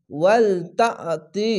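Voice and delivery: ওয়াল তাতি slow, with a bounce on the stopped letter; bouncing stop. Shown as a demonstration. Teacher showing a Quran student the bounce on ওয়াল, slow recitation. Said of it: "ঝোঁক মেরে পড়বেন".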